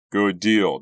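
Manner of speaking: loud exclamation; cried out. A narrator's reading. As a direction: call it out loudly. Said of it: "Doug cried".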